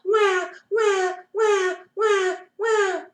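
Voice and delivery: put-on high wailing baby cry; high-pitched mock baby wail. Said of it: "started to cry".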